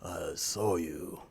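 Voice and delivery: low, menacing whisper of a crime boss; Yakuza boss whisper. Retold or imitated. Tied-up captive hears the Yakuza boss whisper menacingly. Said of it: "the Yakuza boss who's like".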